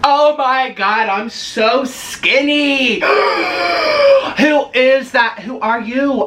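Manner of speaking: high, drawn-out wonder; slow mock awe. Vlogger admiring her reflection, high and slow in mock awe. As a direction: stretch it out like you can't believe it.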